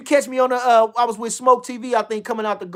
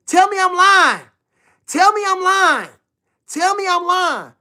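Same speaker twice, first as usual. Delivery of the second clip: defiant challenge; 7 semitones higher than usual. Real, not acted. Daring the chat, repeated challenge.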